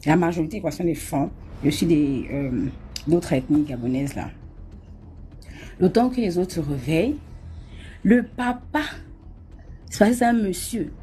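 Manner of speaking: ordinary talk, nothing marked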